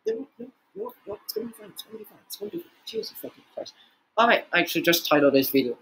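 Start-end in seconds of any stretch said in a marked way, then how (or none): 0.1-3.7 tiny, faint voice, barely holding it together; hushed self-soothing. Real, not acted. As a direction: mutter reassurance to yourself. Consoling himself mid breakdown.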